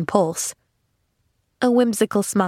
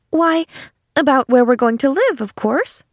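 put-on higher, surprised child's reply; obvious surprise; 6 semitones higher, 3 dB louder than usual. A narrator's reading. Girl explaining where they'll live, surprised. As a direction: answer as if it were self-evident, surprised. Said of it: "rejoined Pollyanna in obvious surprise".

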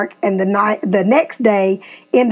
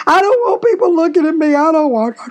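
put-on upset kid; 5 semitones higher, faster than usual. Son's upset words acted out.

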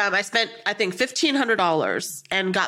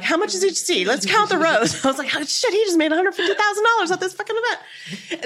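high, excited money-counting; giddy re-enacted astonishment; retold amazement; 8 semitones higher, faster than usual. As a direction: exclaim it pitched up, laughing a little. Retells tallying the psychic's earnings, amazed.

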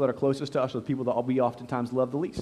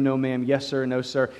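normal talking voice

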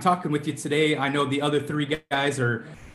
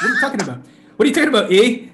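louder, higher playful Canadian-style "eh?" ending; mock Canadian accent; 7 semitones higher, 6 dB louder, much faster than usual. Minnesotan answering Texans in a mock Canadian accent. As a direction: ham up a Canadian accent, laughing it off. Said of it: "you sound Canadian or something".

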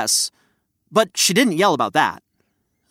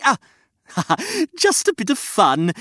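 laughing; 8 semitones higher than usual. Acted. Laughing after poking.

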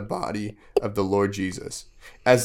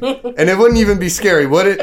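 higher, louder mock-innocent provocation; playful teasing; 9 semitones higher, 8 dB louder than usual. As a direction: goad the kids into disagreeing. Teasing the children to object.

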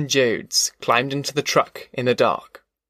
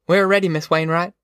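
higher-pitched call to a neighbour; 5 semitones higher than usual. A narrator's reading. Ma telling Miss Wainwright they're ready, calling out. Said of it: "Ma called".

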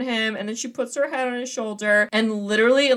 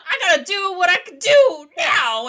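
higher, louder mock-defiant protest; indignant retold outburst; 9 semitones higher, 6 dB louder than usual. Quoting the movie grandpa's defence to co-host, loud and indignant. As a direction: act out an old man loudly standing his ground.

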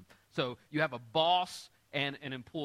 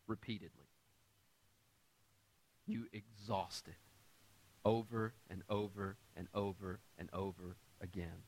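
hushed emphasis; 8 semitones lower, 9 dB quieter than usual. Acted. Preacher drops voice for emphasis.

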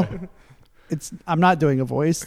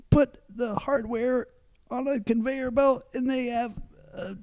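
crazy voice; 5 semitones higher, 7 dB quieter than usual. Acted. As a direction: ham up a manic storyteller. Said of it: "you don't have to do the crazy voice every time".

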